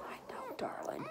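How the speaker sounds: whispered comfort; comforting a scared puppy; soft whisper. Real, not acted. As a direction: hush him tenderly.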